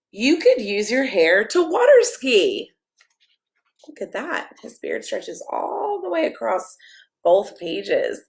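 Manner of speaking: lively picture-book read-aloud, sing-song rhymes; reading to children. Acted. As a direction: read it like a bedtime story for kids.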